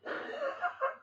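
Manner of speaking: breathless high-pitched giggle; high squealing laugh. Real, not acted. Bursting laugh at the scene.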